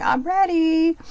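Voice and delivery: a cartoon shark licking its lips; eager shark. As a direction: play the hungry shark raring to go. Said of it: "that one shark in the back going".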